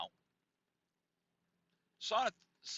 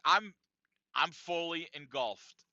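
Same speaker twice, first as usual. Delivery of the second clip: usual speaking voice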